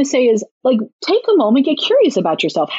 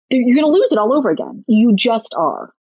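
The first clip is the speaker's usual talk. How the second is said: ordinary delivery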